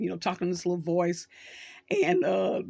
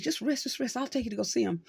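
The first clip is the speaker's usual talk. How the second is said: higher, a lightly voiced goofy Yoda soothing Luke; goofy little creature; 6 semitones higher, 3 dB quieter, faster than usual. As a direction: coax him like a small odd old master, only lightly put on. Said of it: "this little goofy creature".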